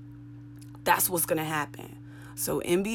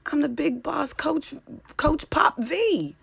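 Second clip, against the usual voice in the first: much higher, slower put-on voice for the nickname; mock boast; 11 semitones higher, slower than usual. Comic boasting her own nickname, squeaky high put-on voice. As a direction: crow it squeaky and slow like a cartoon boss.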